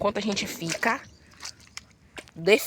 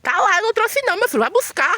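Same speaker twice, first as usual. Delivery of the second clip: high, loud laughing tease; loud playful call; 13 semitones higher, 8 dB louder than usual. Real, not acted. Wife playfully tells husband to go fetch it, loud and high.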